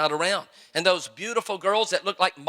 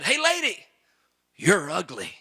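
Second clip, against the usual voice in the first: squawking parrot. Acted. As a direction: play a rude talking parrot mocking a passer-by. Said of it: "that parrot said".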